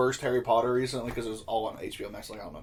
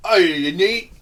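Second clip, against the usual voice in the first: loud, higher mock rough British accent; 7 semitones higher, 12 dB louder, much slower than usual. Showing a friend the rough kind of British accent, loud mock voice. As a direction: bark it like a rough British girl, loud and high. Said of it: "it depends what British accent it is".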